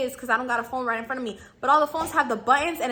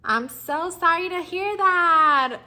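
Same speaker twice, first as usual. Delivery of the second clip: slow, drawn-out, syrupy customer-service apology; fake sweet sympathy; 4 semitones higher, 4 dB louder, much slower than usual. Shown as a demonstration. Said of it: "You just gotta be like".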